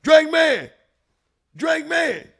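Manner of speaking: imitating a mixtape hype shout; retold hype shout. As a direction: shout it like a rapper hyping up a mixtape intro. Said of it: "So at the beginning of the tape, he".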